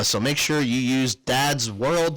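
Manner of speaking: ordinary talk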